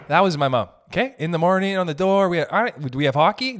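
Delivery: ordinary delivery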